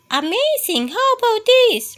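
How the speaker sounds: high squeaky good night; high mouse voice. Acted. Mouse saying good night to Bear, high squeaky voice. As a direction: chirp it up high and bright.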